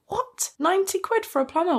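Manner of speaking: high, fast, disbelieving "What?"; outraged disbelief. Retold or imitated. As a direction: shriek the price back at him like it's absurd. Outraged at plumber quote.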